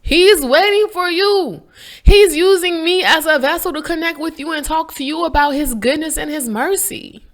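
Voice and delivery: high, impassioned preaching; fervent exhortation. Real, not acted. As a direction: press it on them with high, rising fervour. Urges listeners with fervour.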